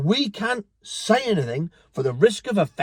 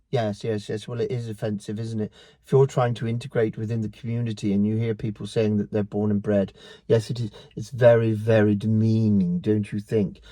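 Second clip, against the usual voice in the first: mocking prissy voice; 8 semitones lower than usual. Vlogger mocks an imagined offended critic in a prim voice. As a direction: sneer it as a smug do-gooder.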